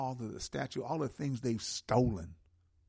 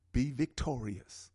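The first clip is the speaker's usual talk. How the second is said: ordinary talk, nothing marked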